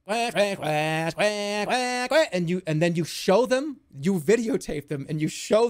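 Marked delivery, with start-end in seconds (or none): 0.1-2.3 mock alien quacking. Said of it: "And they're like".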